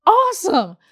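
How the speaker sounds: gasp, then high, loud delight; hammed-up thrilled response; mock excitement. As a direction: act out an over-eager reaction. Retelling reaction to staying nearby.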